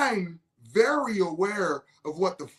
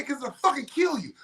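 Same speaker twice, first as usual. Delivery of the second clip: high-pitched acted comeback; heated warning; 8 semitones higher than usual. Opponent warning the microdoser in a retold debate, heated warning. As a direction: hit back with a warning, replaying the row.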